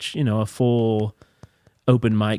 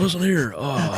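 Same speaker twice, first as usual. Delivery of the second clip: hammed-up folksy president voice; Joe Biden; 6 semitones higher, slower than usual. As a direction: mimic a folksy elderly president. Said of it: "Adam Ray was Joe Biden".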